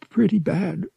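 put-on weak, high sick boy's voice; struggling to speak. A narrator's reading. Feverish boy answering the Wookiee, struggling to speak. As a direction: force it out feebly, pitched high. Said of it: "Han struggled to speak".

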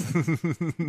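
train noise made with the mouth; chugging toy train voice. Shown as a demonstration. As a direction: chug like a steam train. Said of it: "I'm gonna do my train sound".

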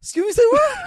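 very high, loud mock disbelief; incredulous comeback. Storyteller replaying his comeback to her scissors threat, incredulous. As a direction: squawk it in outraged disbelief.

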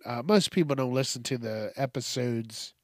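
ordinary talk, nothing marked

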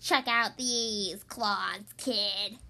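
slow, low rasp; raspy monster. A narrator's reading. Third monster shows off claws to the kid, low slow rasp. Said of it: "a third voice from under the bed rasped".

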